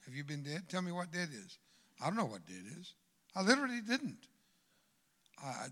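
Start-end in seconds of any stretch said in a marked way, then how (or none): none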